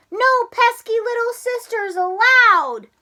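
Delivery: high, loud dismissive shout; cry. Acted. Froggy shouting his little sister away, loud. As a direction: brush her off with a shout.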